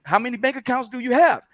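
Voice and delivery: high put-on voice for Andrea's question; imitating Andrea. Friend retells Andrea quizzing him, high put-on voice. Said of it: "'cause I can't do her voice".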